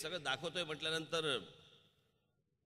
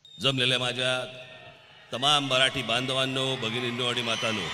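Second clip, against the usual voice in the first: rally speech; 9 dB louder than usual. Real, not acted. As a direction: call out to the whole crowd. Rally opening to crowd.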